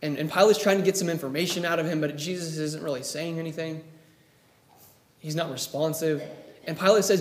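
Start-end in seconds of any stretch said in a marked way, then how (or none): none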